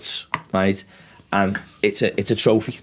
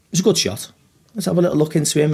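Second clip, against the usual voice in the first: raised, knowing voice; high mock suspicion; 8 semitones higher, faster than usual. Imagined officials suspicious.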